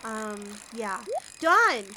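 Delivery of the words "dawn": louder call of the name. Real, not acted. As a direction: call across the room to a friend. Calling out to a chatter.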